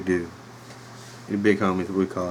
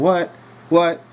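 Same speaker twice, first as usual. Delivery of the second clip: high, loud, barked what; Stone Cold what; 8 semitones higher, 4 dB louder than usual. Retold or imitated. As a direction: bark it like the wrestler. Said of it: "how Stone Cold would do it".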